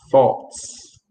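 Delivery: "thoughts" repeated with a drawn hiss on the final "ts"; final s pronounced. Shown as a demonstration. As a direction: model the ending of "thoughts" for a learner. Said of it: "You have to say thoughts".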